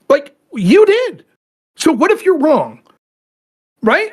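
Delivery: accusing, pointed. Acted. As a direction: lay the blame squarely on them.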